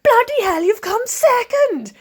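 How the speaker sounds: high, loud excited Welsh man cheering; Welsh accent. Retold or imitated. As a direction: belt it out in a big Welsh accent, delighted. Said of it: "try and do the Welsh accent".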